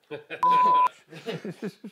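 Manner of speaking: loud, high-pitched laugh; sudden big laugh; real burst of laughter. Real, not acted. Laughing at own off-topic plug.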